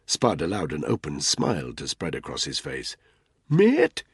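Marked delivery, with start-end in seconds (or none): 3.5-4.0 high, loud innocent greeting; matey bluff. A narrator's reading. Spud caught snooping, high loud matey bluff. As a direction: hail them brightly to cover up.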